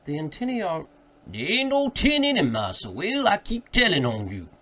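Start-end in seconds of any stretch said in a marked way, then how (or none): 1.3-4.5 much higher and louder, a put-on character voice; an old servant insisting over his master; loud, high-pitched protest in dialect; Jupiter's voice. A narrator's reading. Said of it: "here interrupted Jupiter".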